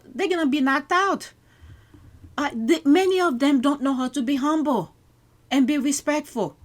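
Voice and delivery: loud, high, heated rant. Real, not acted. Commentator ranting at cocky Americans, loud and heated. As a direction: push it out hard, fired up.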